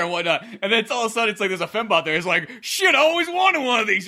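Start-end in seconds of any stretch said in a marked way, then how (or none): 2.6-4.0 much higher, louder gleeful grab; delighted find, voice jumping up; a hammed-up greedy space rogue; eager rogue. Voicing Dag seeing fembot.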